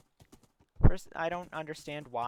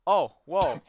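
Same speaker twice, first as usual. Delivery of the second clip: louder, mock-astonished exclamation; hammed-up amazement; amazed reaction; 2 semitones higher, 11 dB louder than usual. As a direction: act out an awed reaction. Voices the friends' astonished reaction.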